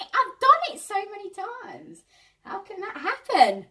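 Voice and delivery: high-pitched mock-frustrated wail; exasperated disbelief. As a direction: protest in high, loud disbelief. Voicing frustrated thought when things go wrong.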